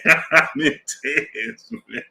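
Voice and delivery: slow words squeezed out through laughter; real laughter breaking into the words; laughing. Real, not acted. Streamer recalling Marlo's videos, laughing through it.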